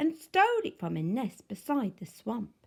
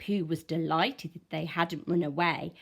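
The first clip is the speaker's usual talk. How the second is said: ordinary delivery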